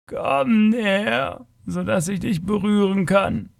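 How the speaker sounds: halting, stretched plea from an alien being; hesitant creature. A narrator's reading. Creature asks the stranger to come within touching distance, hesitant. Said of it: "sprach die Kreatur zögerlich".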